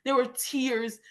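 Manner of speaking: ordinary talk, nothing marked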